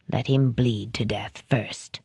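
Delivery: low, soft, icy command; understated chilling villain; cold soft order. A narrator's reading. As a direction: calmly order cruelty.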